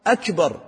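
"أكبر" begun with a firm glottal stop; clear hamza. Shown as a demonstration. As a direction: articulate the "أ" of "أكبر" sharply. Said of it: "لازم تنطق الهمزة".

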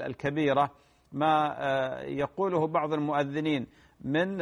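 ordinary talk

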